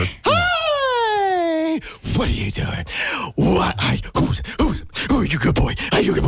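put-on high excited baby talk to the dog; dog-greeting voice. Owner greeting the dog at the door, excited baby talk. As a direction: gush over the dog as it runs up. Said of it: "how do you talk to Odin".

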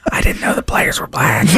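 whispered wide-eyed aside; mock hushed astonishment. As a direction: whisper it like a stunned kid.

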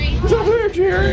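very high crowd holler; heckling fan. Acted. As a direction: egg the star on from the gallery. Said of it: "Here's my line".

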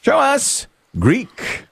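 high, very slow host call, rising; game show reveal. Acted. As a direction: hold the suspense like a TV host.

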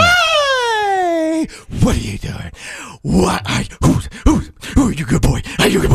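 put-on high excited baby talk to a dog; dog greeting voice. Shown as a demonstration. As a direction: gush over the good boy. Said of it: "how do you talk to Odin".

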